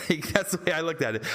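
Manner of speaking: normal talking voice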